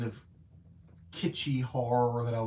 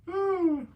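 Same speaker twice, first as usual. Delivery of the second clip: a burst of high laughter; 5 dB louder than usual. Real, not acted. Laughing at own wordplay, high burst of laughter. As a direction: laugh at your own slip.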